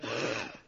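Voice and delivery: short mock gasp of shock; imitated gasp. Teacher replays the woman's shock with a short gasp. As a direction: catch your breath as if stunned. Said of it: "she gasped".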